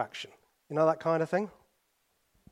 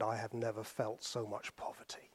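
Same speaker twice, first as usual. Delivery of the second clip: hushed whisper, much softer; whispered quote; 5 semitones lower, 14 dB quieter than usual. Retold or imitated. Preacher quoting Mother Teresa on New York, whispered. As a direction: breathe it out as a reverent whisper.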